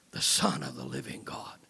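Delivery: whisper. Acted.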